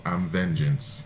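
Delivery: low, slow, hushed self-introduction; deep, deliberate hero line; Batman. Retold or imitated. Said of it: "how he introduced himself".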